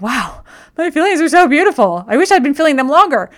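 high, loud, rushed burst of amazement; gushing wonder. Retold or imitated. Retelling her rush of awe at her own feelings, gushing loud and high. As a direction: gush over it like a sudden revelation. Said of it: "I'm like".

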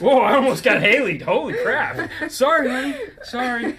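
loud, high, laughing through the words; laughing apology after a near spill. Real, not acted. Near miss, both burst laughing.

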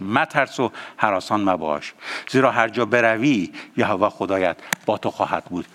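low, soft, reverent reading of مترس و هراسان مباش; quiet verse reading. Shown as a demonstration. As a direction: read the verse gently before prayer. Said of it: "با این آیه دوباره میخونمش".

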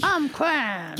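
high, put-on child voice; high-pitched kid bragging; mobster's kid. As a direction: squeak it like a kid playing gangster. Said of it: "his kid's fucking like".

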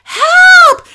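high, loud cry for help; shrill-pitched, drawn-out mock distress call; yelling for help. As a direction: yell it like a panicked boy waving for rescue. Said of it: "he's, like, yelling".

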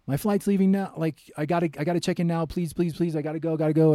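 high, loud, pleading cry to check in; desperate yell. Retold or imitated. Rushing traveller yells to check-in staff, frantic and loud. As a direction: beg the check-in desk at full voice, frantic. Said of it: "So I just, like, yelled".

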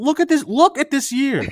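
high, loud, exasperated urging; loud insistence. Real, not acted. Host arguing the year proves doom, loudly insistent. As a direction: raise the voice sharply to press the point.

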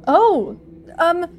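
lightly voiced flustered priest; nervous priest. Priest answering under threat.

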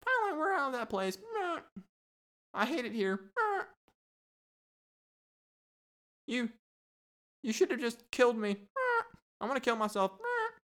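very high squawky bird voice with meh squawks; jailed parrots bickering. Pretend. Parrots complain to each other in bird jail, high squawky whine. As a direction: whine like a miserable caged parrot. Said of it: "talking to each other".

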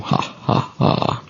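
soft breathy villain chuckle; a quiet sinister cackle; evil whispered laugh. Acted. Spooky laugh after tease.

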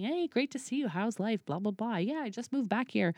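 1 bright chatty greeting. Retold or imitated. Chatty greeting in bar story.

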